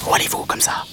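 breathy whisper, no voice; whispered tiny insect voice. Acted. Mantis asking the animals where they go, whispered. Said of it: "demanda l'insecte".

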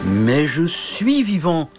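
suddenly awake knight. Acted. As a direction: wake up and correct her. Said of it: "soudain réveillé".

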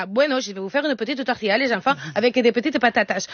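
put-on playful Spanish-accented mum. Mother obliging her children at dinner, Spanish accent. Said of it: "avec l'accent".

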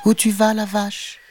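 high cartoon kitten voice; little kitten. A narrator's reading. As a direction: call out like a tiny tabby kitten. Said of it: "demanda-t-il".

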